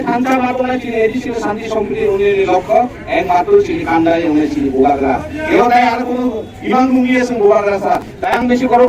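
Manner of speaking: normal talking voice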